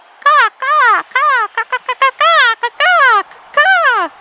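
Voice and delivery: high, loud cawing like a crow; crow caws. Pretend. Pretending to be a bird, high loud crow caws. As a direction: caw like a big noisy bird. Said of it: "I'm a bird".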